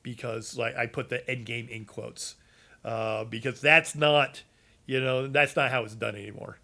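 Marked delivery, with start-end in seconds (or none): none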